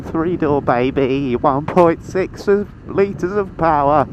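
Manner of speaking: proud brag. Pretend. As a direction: show off your little car like it's a supercar. Bragging about his car.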